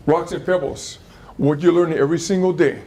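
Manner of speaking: normal talking voice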